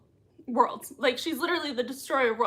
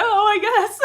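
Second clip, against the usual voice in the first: laughing; 11 semitones higher, 7 dB louder than usual. Real, not acted. Laughing at own post.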